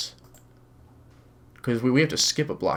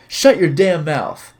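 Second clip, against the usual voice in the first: angry shout; 5 semitones higher, 9 dB louder, slower than usual. Acted. Angry thug shouting in story.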